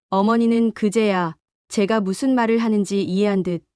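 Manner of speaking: ordinary delivery, nothing marked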